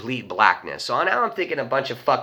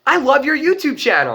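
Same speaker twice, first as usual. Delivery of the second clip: high, gushing fan shout-out; high-pitched mock fanboy; excited fan; 13 semitones higher, 5 dB louder than usual. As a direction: imitate a starstruck fan, pitched way up. Excited fan greeting him, retold.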